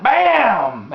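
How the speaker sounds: loud, high, drawn-out bang; shouted gunshot. Retold or imitated. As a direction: fire it off like a rifle shot. Imitating his rifle going off.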